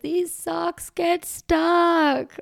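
put-on high, slow child's whine; little kid voice. Parent voicing her small child's complaint in a high, slow child voice.

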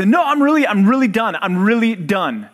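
high, loud mock protest, easing off; imitated insistent child. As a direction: play a small boy insisting to his parents. Son insists he finished, retold.

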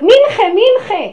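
high, loud, drawn-out yell across the room; retold shout from the crowd; shouted call. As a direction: call out the name loudly and slowly. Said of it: "פתאום מישהו צועק".

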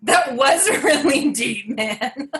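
laughing through the words, slowed; amused, drawn-out laughing reply. Real, not acted. Laughing at partner's deep remark.

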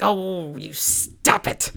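drawn-out, coy telling-off; flustered mock rebuke, stretched slowly; playful scolding. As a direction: playfully brush off a tease.

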